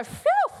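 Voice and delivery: big high relieved exhale; mock relief at being spared; relieved phew. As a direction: blow out a loud sigh of relief. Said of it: "and you go".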